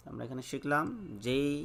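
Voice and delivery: ordinary talk, nothing marked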